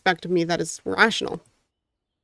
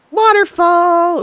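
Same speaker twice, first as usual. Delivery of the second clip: slow, louder, higher cry of discovery; excited reveal; 8 semitones higher, 5 dB louder, much slower than usual. Acted. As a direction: stretch it out in wonder at the waterfall. Reading the reveal aloud.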